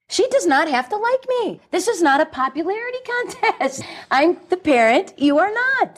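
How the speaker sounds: high, emphatic and insistent; firm parental authority. Acted. A mother telling her daughter who is boss, emphatic and high. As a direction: lay down the law to your own child.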